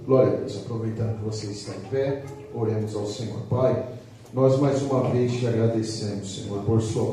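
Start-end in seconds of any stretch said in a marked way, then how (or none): none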